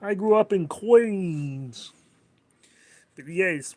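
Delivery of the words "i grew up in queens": put-on thick Queens accent, higher and louder; New York accent. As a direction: ham up a Queens New Yorker announcing the schedule. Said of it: "talking like this".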